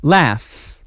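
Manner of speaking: usual speaking voice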